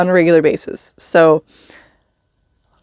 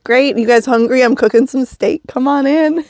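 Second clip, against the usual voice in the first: cheerful welcome; 6 semitones higher than usual. Retold or imitated. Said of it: "And I was like".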